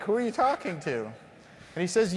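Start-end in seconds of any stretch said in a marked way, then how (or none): 0.0-1.0 high, startled disbelief; can't believe he's asking me; incredulous surprise. Retold or imitated. To the surgeon asking him, high startled disbelief. Surgeon asks engineer what next.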